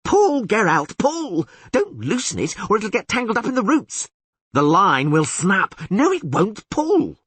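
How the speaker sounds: put-on high, eager, excitable young bard; excited bard voice. Dandelion marvels at the huge catfish, high and excited.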